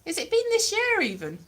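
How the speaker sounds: ordinary delivery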